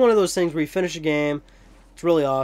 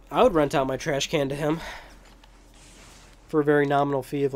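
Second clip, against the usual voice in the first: ordinary delivery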